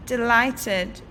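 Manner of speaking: plain reading voice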